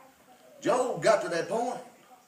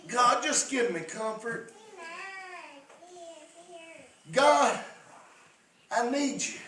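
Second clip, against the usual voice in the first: a hint of Job pleading, higher and louder at first, then fading; pleading prayer; 6 semitones higher, 5 dB louder than usual. Preacher voicing Job crying out to God, pleading. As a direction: beg God for comfort, voice dropping to a hush.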